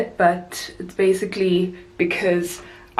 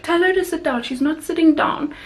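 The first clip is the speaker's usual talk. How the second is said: put-on little boy voice; 8 semitones higher than usual. Boy imitated asking the crew member about his sister, in a high polite kid voice. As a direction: imitate a prim six-year-old asking politely. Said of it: "he's like".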